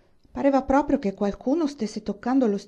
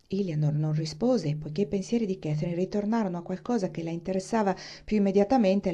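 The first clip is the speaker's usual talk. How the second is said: usual speaking voice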